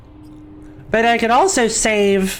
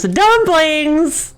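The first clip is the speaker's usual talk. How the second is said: excited exclamation; 6 semitones higher than usual. Acted. Gamer echoing the daydream snack, excited exclamation. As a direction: call it out with mock relish. Echoes favourite snack while reading.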